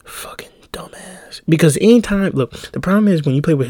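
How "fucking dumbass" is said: whispered under the breath; muttered insult. Retold or imitated. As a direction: hiss the insult so the ref can't hear. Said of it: "I was like".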